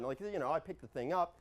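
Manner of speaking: ordinary talk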